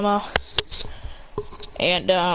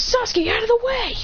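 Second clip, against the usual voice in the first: high-pitched mock yell; urgent warning; 13 semitones higher than usual. Fighter warning Sasuke of the air palm, urgent call. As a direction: call out a warning across the ring.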